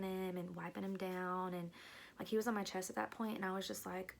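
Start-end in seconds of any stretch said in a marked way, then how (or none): none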